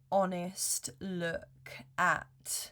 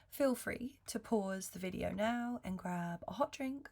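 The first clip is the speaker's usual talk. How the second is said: ordinary talk, nothing marked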